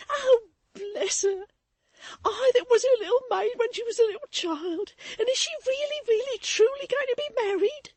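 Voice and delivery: high, loud tearful exclaiming; joy and grief at once; pain and pleasure. A narrator's reading. Maid hears of Florence's marriage, high tearful exclaiming. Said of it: "exclaimed Susan in a burst of pain and pleasure".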